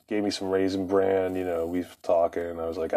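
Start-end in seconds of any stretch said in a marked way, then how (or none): none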